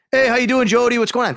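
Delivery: loud, high New York greeting; imitated New York caller, loud and high-pitched; thick New York accent. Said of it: "Thick New York accent, too".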